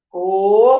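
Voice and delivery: "Coruja" split into syllables, "co... ru... ja", each held; syllable-by-syllable reading. Retold or imitated. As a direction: read "Coruja" like a child sounding out syllables, rising on "co". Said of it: "foi lendo".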